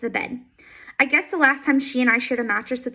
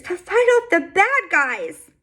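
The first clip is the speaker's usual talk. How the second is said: whimpering; 7 semitones higher, slower than usual. A narrator's reading. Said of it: "still whimpering".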